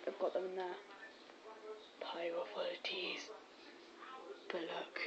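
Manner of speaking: hushed secret whisper. Real, not acted. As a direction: whisper it conspiratorially. Secretive aside about the bag.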